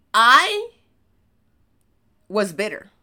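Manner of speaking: long suspense pause before "was bitter"; dramatic pause. Shown as a demonstration. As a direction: hold the silence, then drop "was bitter". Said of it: "I paused there for, uh, suspense".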